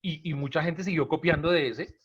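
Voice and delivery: ordinary delivery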